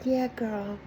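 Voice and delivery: put-on flat, quiet "yeah, girl"; a barely bothered "Mm, yeah, girl"; half-hearted acknowledgement. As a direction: mumble it like you barely care. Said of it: "That half-hearted acknowledgement".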